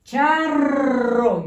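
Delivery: "Charro" with a firm rolled rr; teacher rolling the rr in "Charro" for the class; strong trilled rr. Shown as a demonstration. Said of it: "tiene que ser fuerte".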